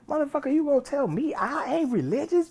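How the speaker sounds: rising high, loud indignant yell; mock outrage. Telling friend he is religious, rising indignant yell. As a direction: build into a high furious retort. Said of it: "I was like".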